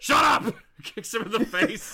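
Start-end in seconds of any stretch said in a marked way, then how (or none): 0.0-0.5 high, loud cut-off shout; snapped shout. Acted. Hero silencing the heckling villain, snapped shout. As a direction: shut him up sharply.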